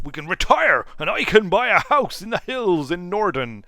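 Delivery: usual speaking voice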